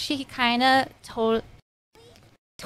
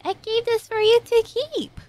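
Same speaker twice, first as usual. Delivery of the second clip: a much higher imitation of the girl; mock girl voice; 12 semitones higher than usual. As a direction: put on her high teasing voice. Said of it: "She's like".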